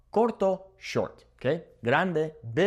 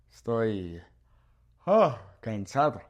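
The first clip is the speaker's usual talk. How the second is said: acted tiredness with yawn; 2 semitones lower than usual. Teacher demonstrates 'cansado', yawning in mock tiredness. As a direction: yawn in the middle of the phrase. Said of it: "Did I make you yawn?".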